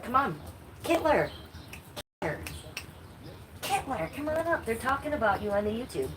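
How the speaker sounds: high, coaxing pet call; calling a cat. Real, not acted. Streamer calls her cat to the window, high coaxing call. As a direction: coax the kitty over in a high little voice.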